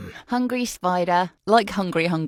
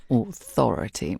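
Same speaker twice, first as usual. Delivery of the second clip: low, falling attempt at authority; low mock-authoritative delivery; failed authoritative voice; 8 semitones lower than usual. Shown as a demonstration. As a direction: try to sound commanding and miss. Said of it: "Wow, that was shit".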